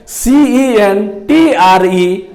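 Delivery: letters सीईएनटीआरई spelled very slowly; slow spelling dictation. Shown as a demonstration. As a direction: spell each letter apart. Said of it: "centre".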